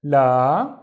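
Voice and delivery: la with a loud, long aa; long vowel. Shown as a demonstration. Teacher reading the first syllable, la held long. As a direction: stretch the vowel in la. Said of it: "long because of the alif".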